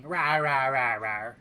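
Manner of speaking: cute roaring monster; dinosaur voice. Pretend. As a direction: roar like a playful little dinosaur. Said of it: "scary and ferocious being".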